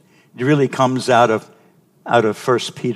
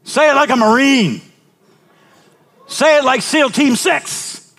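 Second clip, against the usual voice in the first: loud, high rallying cry; loud rallying call; 5 dB louder than usual. Real, not acted. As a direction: fire the men up. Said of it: "pastor rousing the men".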